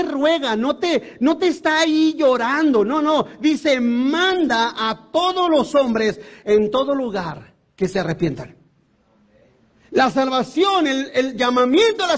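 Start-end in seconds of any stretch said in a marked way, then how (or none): 3.8-8.6 "Manda a todos los hombres" stretched very slow, each word weighed; solemn slow scripture reading; slow emphatic reading. Shown as a demonstration. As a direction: read the command slowly, word by word. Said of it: "reading the verse slowly".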